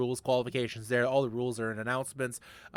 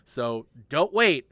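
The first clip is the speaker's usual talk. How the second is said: slow, drawn-out don't wait; slow emphatic; 3 dB louder, much slower than usual. Real, not acted. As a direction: stretch each word to drive it home. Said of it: "repeats the urge, slowed down".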